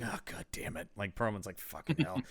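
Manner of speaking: low, soft annoyed mutter; muttered grumble. Retold or imitated. Perlman muttering as his uncle calls. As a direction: grumble under your breath. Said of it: "he's like".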